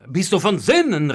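loud, flabbergasted cry; aghast. A narrator's reading. Master hearing a dead man's name, aghast. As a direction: call out in disbelief. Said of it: "rief Pandolfo entgeistert".

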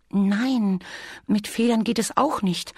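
high, light bee voice; little bee character. Acted. Bee reminds Bear what bears can't do, cautioning. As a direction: voice a small bee, patiently cautioning.

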